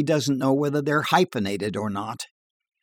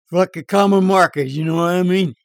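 higher, louder bar yarn voice; tall-tale barfly; 6 semitones higher, 6 dB louder than usual. Acted. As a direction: spin a dirty joke in a bar.

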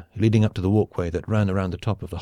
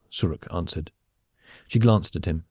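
plain reading voice

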